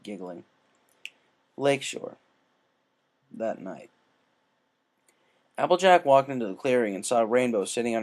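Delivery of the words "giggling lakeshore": ordinary delivery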